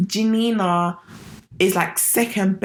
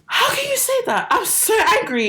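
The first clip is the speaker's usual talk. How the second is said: much higher, hammed-up mock anger; mock angry outburst; 14 semitones higher than usual. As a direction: squeal it like an overdone tantrum. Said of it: "He was like".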